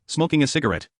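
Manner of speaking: ordinary delivery, nothing marked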